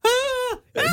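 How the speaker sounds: very high imitated shriek of the startled woman; mock scream. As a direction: squeal it like the frightened lady. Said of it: "Niinku huutaa". (matkittu kimeä kiljaisu)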